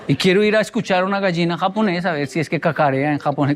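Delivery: ordinary talk, nothing marked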